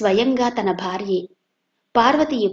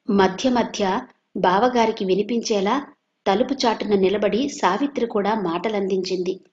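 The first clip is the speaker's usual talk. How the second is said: usual speaking voice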